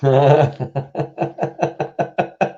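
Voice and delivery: long staccato laugh, rising high; real laugh. Real, not acted. Co-host amused by the decal, laughing. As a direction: burst out, then keep chuckling in short pulses.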